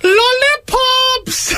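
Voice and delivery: very high, drawn-out sing-song call; child catcher. Acted. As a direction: lure children with a long falsetto cry. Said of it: "like the child catcher".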